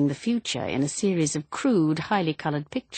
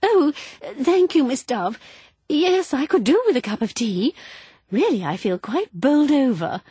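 startled; 9 semitones higher, 5 dB louder than usual. A narrator's reading. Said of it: "Missus Val jumped".

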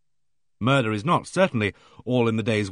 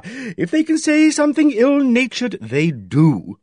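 loud, high, brisk know-it-all; hearty bossy lady; 3 semitones higher, 6 dB louder than usual. Acted. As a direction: play her booming and self-assured. Aimee Griffith's hearty voice.

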